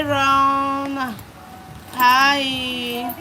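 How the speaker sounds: ordinary delivery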